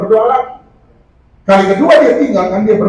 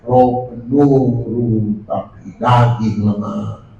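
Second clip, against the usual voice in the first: slow, lower, drawn-out mock of a lazy congregant; lazy excuse; 11 semitones lower, much slower than usual. Preacher mimicking a church member's lazy excuse, slow and low. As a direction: drag it out like a sleepy excuse. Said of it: "Dia bilang".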